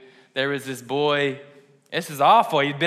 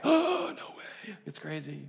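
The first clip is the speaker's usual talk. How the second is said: mock amazement; 4 semitones higher, 9 dB quieter, slower than usual. Awed reaction to surprise homecoming.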